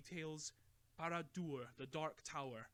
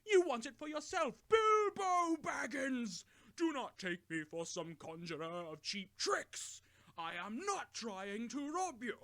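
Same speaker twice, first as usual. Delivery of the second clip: much higher, much louder roar; thundering rage; 9 semitones higher, 11 dB louder than usual. A narrator's reading. Gandalf rising up in fury against Bilbo, thundering. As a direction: boom it to silence him.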